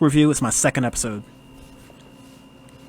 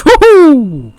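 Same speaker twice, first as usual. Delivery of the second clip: excited hoot; 11 semitones higher, 9 dB louder than usual. Real, not acted. Reacting to a tidal wave.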